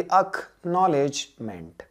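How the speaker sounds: acknowledgement drawn out very slowly; slow model pronunciation. Shown as a demonstration. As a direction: stretch acknowledgement so students hear each part. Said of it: "पहले क्या बोलो?".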